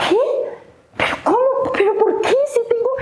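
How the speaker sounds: chillido muy agudo de asombro; no puede creer que la encerraron; incredulidad. Retold or imitated. Descubre que está encerrada. (asombro agudo e incrédulo)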